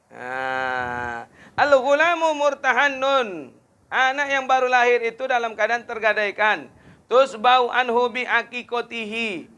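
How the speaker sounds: high, loud impassioned preaching voice; fiery preaching. Acted. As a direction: lift the voice and declaim to the crowd. Preaching at full force.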